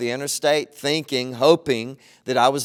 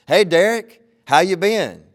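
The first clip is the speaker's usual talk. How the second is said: loud, higher eager greeting; excited stranger; 5 semitones higher, 5 dB louder than usual. Retold or imitated. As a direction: call out to an old friend. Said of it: "this is what the guy says".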